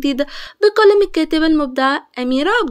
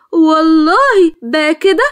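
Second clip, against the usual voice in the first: louder, very slow and drawn-out challenge; defiant retort; 5 dB louder, much slower than usual. Acted. Hoor standing with hands on hips to challenge the assistant, defiant. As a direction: drag out the words like a dare.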